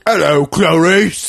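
overdone British villain, slow and loud; British Hannibal Lecter. Retold or imitated. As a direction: drawl out a hammy British cannibal greeting. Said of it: "it's more like".

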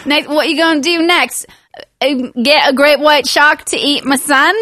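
hammed-up Australian outrage; mock Australian accent. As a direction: rant as a cartoon Australian dad. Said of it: "But he's Australian".

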